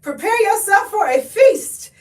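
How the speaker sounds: high, loud mock-excited friend voice; imitating a friend. Vlogger imitating a friend thrilled over a cheap Costco buy. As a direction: play the hyped friend bragging about a bargain. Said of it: "She's like".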